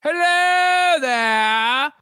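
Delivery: high, drawn-out welcoming character voice; shopkeeper figure's voice. Acted. Game master as shimmering figure greets adventurers, high slow character voice. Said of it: "you can hear a voice coming from it".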